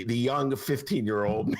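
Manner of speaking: normal talking voice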